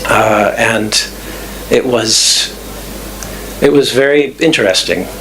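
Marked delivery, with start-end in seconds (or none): none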